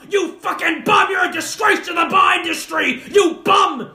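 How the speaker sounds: high, full-volume ranting bellow; Bar Rescue host. Acted. Bar expert tearing into a bar owner, shouted impression. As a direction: berate them at the top of your voice.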